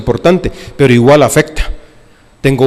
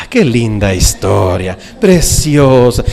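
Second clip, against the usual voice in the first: dreamy swoon; 5 semitones lower, slower than usual. Retold or imitated. People swooning over love story.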